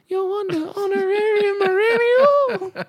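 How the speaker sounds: hammed-up weepy wail; mock high crying wail. Shown as a demonstration.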